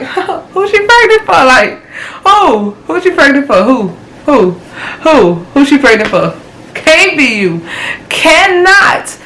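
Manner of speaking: high-pitched, rattled disbelief; incredulous questioning. Retold or imitated. Demanding who got her pregnant, in high incredulous shock. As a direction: repeat it in rising shock, refusing to believe it.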